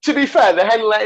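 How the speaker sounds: laughing. Real, not acted. Catches himself saying the catchphrase.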